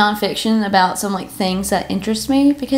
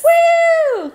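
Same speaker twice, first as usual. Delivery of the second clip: very high, loud whoop; excited cheer; 4 dB louder than usual. Real, not acted. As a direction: cheer the topic like a fan. Cheering podcast topic.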